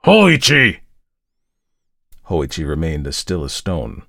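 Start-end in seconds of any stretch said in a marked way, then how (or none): 0.1-0.8 savage, high, very loud call; furious summoning yell; savage shout. A narrator's reading. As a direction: shout the name savagely at full force.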